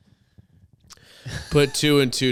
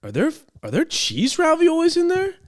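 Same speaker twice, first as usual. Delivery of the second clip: very high, disbelieving squeak; incredulous question; 3 dB louder than usual. Retold or imitated. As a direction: ask it in shocked disbelief. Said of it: "I'm like".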